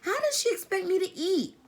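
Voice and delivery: very high, loud disbelieving cry; fretful girl protesting to her brother; exasperated outburst. A narrator's reading. Meg outraged, worried.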